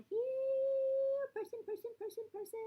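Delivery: put-on singing out each person for a small child; sing-song. Parent pointing at a picture book, in a high sing-song.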